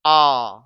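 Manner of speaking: आ drawn out as a long open aa; teacher sounding out आ. Shown as a demonstration. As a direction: sing out आ as a clear long vowel. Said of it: "इसे बोलेंगे".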